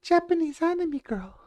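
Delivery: put-on high squeaky anime girl voice. Shown as a demonstration. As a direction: pitch way up like a cartoon anime girl.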